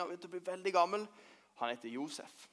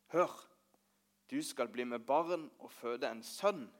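a soft, slow, reverent message; solemn angel quote; 3 semitones lower, 8 dB quieter, slower than usual. Retold or imitated. Josef tells the children what the angel said, softly. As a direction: speak the angel's words gently and slowly. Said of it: "og så sa engelen dette".

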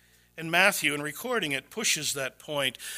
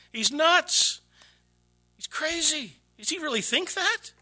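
mock disbelief; 11 semitones higher than usual. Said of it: "And you're gonna say".

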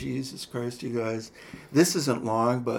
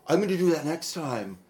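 gasp, then a higher, eager line; light up at the idea; thrilled plan after a gasp; excited realization; 6 semitones higher than usual. Real, not acted. Gasps at a new idea.

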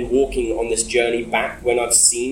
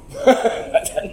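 short high breathy laugh; 13 semitones higher than usual. Real, not acted. Scoffing at the visa threat, a high laugh. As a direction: laugh it off as nonsense.

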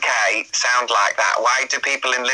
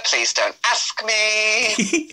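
high, drawn-out playful protest; mock-innocent, pleading denial; squeaky put-on innocence; coy denial; 9 semitones higher than usual. Coy denial about season two cast.

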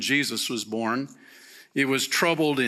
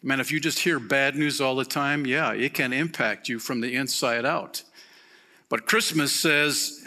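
ordinary talk

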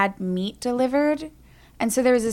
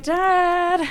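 drawn-out, high-pitched teasing call; singsong mock reproach; teasing dad; 6 semitones higher than usual. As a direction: needle him with one stretched word. Teasing dad about the old prank.

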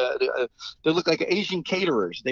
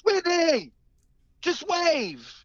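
calling out to the limo; much slower than usual. Retold or imitated. [name] calls to [name] in the limousine, high calling voice.

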